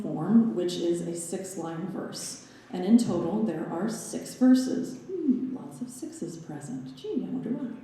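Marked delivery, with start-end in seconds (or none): 5.1-7.7 sarcastic whispered aside. Real, not acted. Sly aside about the sixes.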